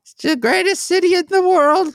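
a silly character complaining about sewage; high comic neighbour voice. Pretend.

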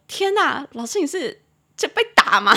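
high, loud imitation of aghast students; mock student shock. Students see teacher's marks at work, imitated shock. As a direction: exclaim in hammed-up alarm.

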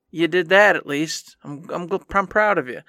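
ordinary talk

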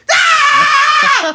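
kendo battle cry. Retold or imitated. Students charging each other with a loud kiai yell. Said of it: "You go".

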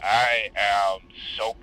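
put-on slow, slurred drunk voice. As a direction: let it sag like a drunk. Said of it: "Are you drunk?".

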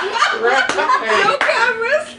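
high, slow, laughing disbelief; amused disbelief. Real, not acted. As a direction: echo it back, stunned and amused. Incredulous laughing echo.

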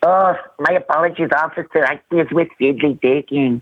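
put-on a lazy Mexican accent, lightly done; lightly accented apology to a police officer; mock Mexican accent. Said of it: "I'll do one for you guys".

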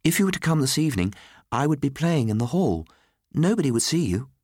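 put-on high, eager small boy; young Nicholas. A narrator's reading. Boy invites Miss Grey this evening, high child's voice. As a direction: propose it as a child, pitched high. Boy makes a secret offer.

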